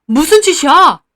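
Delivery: father shouting at the overturned plate; startled shout. Retold or imitated. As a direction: yell in shock. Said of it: "저희 아빠도 놀라서 소리 질렀어요".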